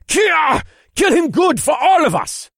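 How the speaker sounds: high, loud rallying cry; battle shout. Acted. Warrior shouting encouragement in battle.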